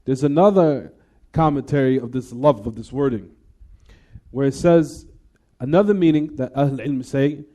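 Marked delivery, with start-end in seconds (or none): none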